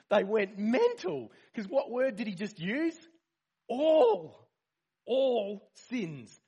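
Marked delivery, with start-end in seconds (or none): 3.7-6.4 "All" flung out high and loud, then "All sins" stressed. Real, not acted. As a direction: belt out "All" like a proclamation. Preacher hammering Jesus' word all.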